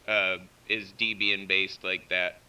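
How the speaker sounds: ordinary talk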